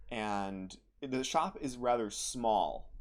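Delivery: usual speaking voice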